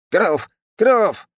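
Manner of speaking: very high, punchy one-word yelps; yelling treant child. Acted. Little treant answers his father, high yelping. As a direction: squawk it high and loud.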